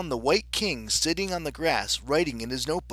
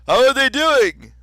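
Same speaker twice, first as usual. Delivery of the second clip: high, loud kingly shout; calling out; 12 semitones higher, 13 dB louder than usual. Acted. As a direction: call over the din of a fight. King calls over the fight.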